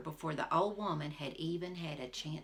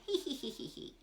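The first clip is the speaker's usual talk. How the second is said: high cackling hee hee; witch's cackle; 9 semitones higher, 4 dB quieter than usual. A narrator's reading. The witch bounces off the hackle, cackling high. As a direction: cackle like a gleeful old witch. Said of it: "danced all around laughing".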